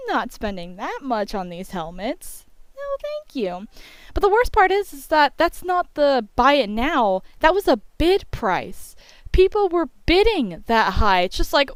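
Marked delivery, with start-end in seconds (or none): 2.8-3.7 sarcastic refusal. Real, not acted. Refusing overpriced eBay helmets. 4.1-11.2 indignant rant. Real, not acted. Outraged at bid prices.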